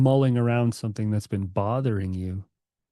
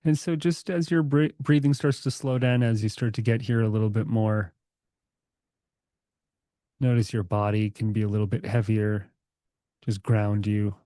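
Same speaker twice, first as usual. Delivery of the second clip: calm, unhurried meditation guide; guided meditation. Acted. Guiding the group to relax their bodies, calm meditation voice. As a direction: ease the group into stillness, gently.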